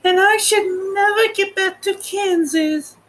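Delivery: put-on very high little-girl voice; Dorothy voice. Dorothy fretting to her friends, in a very high little-girl voice. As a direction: pitch right up like a young girl. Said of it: "said Dorothy".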